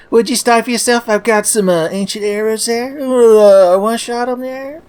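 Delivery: high grumbling sitcom dad; Archie Bunker impression. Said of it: "my beloved Archie and Edith Bunker impressions".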